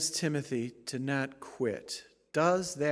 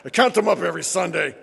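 fast, louder, laughing through it; laughing mock complainer; 3 semitones higher, 12 dB louder than usual. Pastor joking as a congregant tallying his mistakes, laughing. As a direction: keep laughing as the fussy churchgoer.

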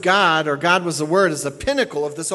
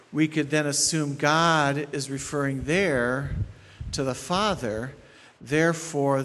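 normal talking voice

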